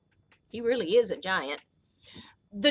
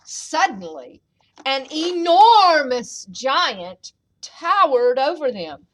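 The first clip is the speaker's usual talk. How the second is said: ordinary delivery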